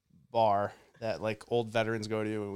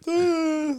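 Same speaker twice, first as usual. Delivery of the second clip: high mock shriek; 8 dB louder than usual. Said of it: "we're all like".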